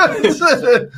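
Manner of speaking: high giggling laugh. Real, not acted. Guest laughing at own cheeky pick, high giggle. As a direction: giggle through the first word.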